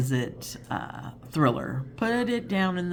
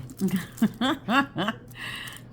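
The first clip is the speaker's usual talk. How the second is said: laughing; 7 semitones higher than usual. Real, not acted. Laughing at own attempt.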